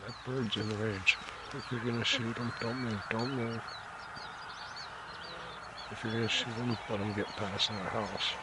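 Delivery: hushed hunter's whisper; wildlife watching whisper. Real, not acted. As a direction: keep it low so the bird won't hear. Whispering while hunting a bird.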